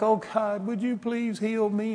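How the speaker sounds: mock a meek, pitiful plea; pathetic plea. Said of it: "they come and it's pathetic".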